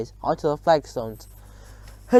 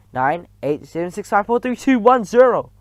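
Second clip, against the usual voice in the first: rising higher and louder to zero; building countdown; 5 semitones higher, 6 dB louder than usual. A narrator's reading. Witch's countdown to the spell, climbing to a cry. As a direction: build it to a climax.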